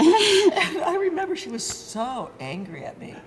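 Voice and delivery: high, loud laughing retelling; amused at her own boldness, laughing. Real, not acted.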